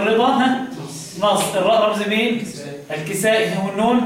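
ordinary delivery

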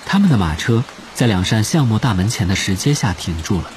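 ordinary delivery

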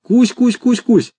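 high, gentle calling to a pet; coaxing call. A narrator's reading. Commandant coaxes the pterodactyl down from the roof, calling sweetly. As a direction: call the creature kindly, pitch up. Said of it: "ласково позвал".

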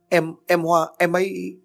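high, broken stammer; child choking on words, tearful; stammering in tears. A narrator's reading.

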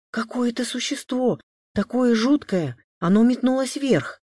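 high, agitated account of a scare; loud, high-pitched fright; frightened. A narrator's reading. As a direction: tell it still shaken and alarmed. Frightened Masha describes the creature.

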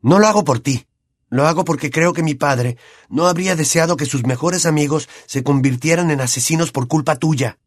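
lightly voiced indignant boy; firm rejection. A narrator's reading. Harry firmly rejecting Pettigrew's thanks.

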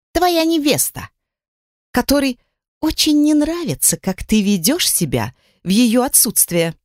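high shrill indignant voice; rising to a squeal of outrage. Acted. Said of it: "голос Миты приобрёл визгливые нотки".

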